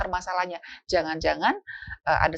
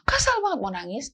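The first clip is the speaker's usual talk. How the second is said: put-on upset child; 7 semitones higher than usual. Child voiced venting frustration.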